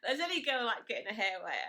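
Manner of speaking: put-on laughing at a boy who hates wet hair, loud and incredulous. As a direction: ask it like it's obvious nonsense. Laughing disbelief at Luke's remark.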